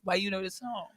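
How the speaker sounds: ordinary delivery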